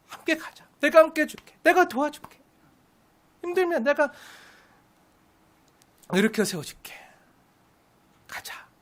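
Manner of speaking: gentle coaxing. Pretend. God coaxing his child along.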